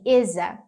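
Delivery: "is a" run together as one sound; "is a" linked smoothly. Shown as a demonstration. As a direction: glide "is" into "a". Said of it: "fica meio assim".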